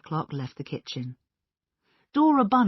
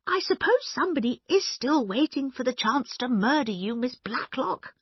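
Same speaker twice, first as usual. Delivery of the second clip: high voice; 9 semitones higher, 3 dB louder than usual. Retold or imitated. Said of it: "in that high voice".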